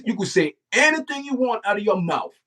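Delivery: normal talking voice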